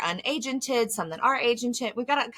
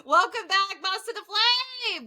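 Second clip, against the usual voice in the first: high, bright, laughing welcome back; cheery podcast host; 11 semitones higher than usual. Pretend. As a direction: restart as the upbeat host. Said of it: "No, wrong podcast".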